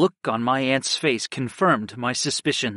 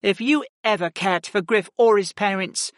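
higher, firm commanding woman; Ashla the Ice Mage; 10 semitones higher than usual. A narrator's reading. Ice Mage ordering the aunt to give up the book, stern. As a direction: lay down the law, steady and stern.

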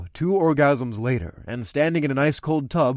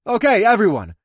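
declaring; 9 semitones higher, 6 dB louder than usual. Acted. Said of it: "I declared".

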